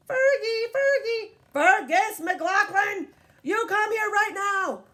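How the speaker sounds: the last-warning call to a disobedient dog; exasperated owner scolds; stern full-name call. Acted. Owner loses patience, uses full name.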